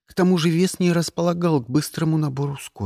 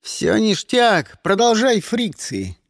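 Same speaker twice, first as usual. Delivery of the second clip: slow, high shout; shouting back; 6 semitones higher, slower than usual. A narrator's reading. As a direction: call it back over the noise. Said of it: "крикнул я в ответ".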